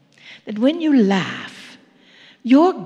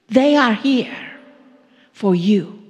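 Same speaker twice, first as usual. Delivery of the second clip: usual speaking voice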